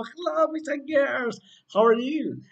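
high, drawn-out cheerful hello to the class; teacher bursting back into view with a sung-out hello; big excited greeting. Acted. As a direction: call out a joyful hello. Teacher greets class after clouds clear.

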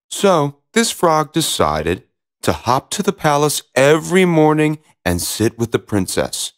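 usual speaking voice

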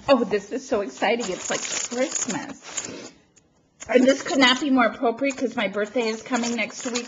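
normal talking voice

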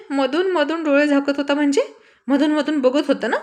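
indignant sister snapping back; accusing. Acted. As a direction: throw his words back at him. She slaps him, accusing.

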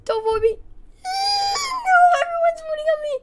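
very high squealing plea; panicked wail. Real, not acted. Player as everyone's voting on them, panicked high wail.

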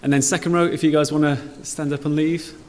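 normal talking voice

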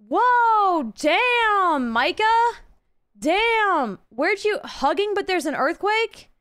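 high, loud shocked reaction; startled exclamation; surprised. Real, not acted. Reacting to teammate's emote.

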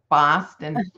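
Boston said the Boston way, dropped R, PAHS-tun; a quick Boston-accent Boston. Shown as a demonstration. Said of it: "If you were in Boston".

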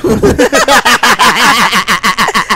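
loud real laughing fit; co-caster laughing hard at his own joke. Real, not acted. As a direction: crack up loudly.

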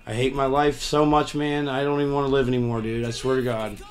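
usual speaking voice

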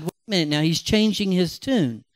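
ordinary talk

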